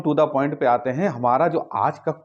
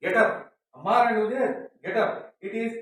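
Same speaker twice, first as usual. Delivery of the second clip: put-on high voice calling the kids to get up; waking kids; 6 semitones higher, 5 dB quieter, much slower than usual. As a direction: play the parent rousing sleepy kids. Parent wakes the kids. (ऊँची आवाज़ में बच्चों को जगाते हुए)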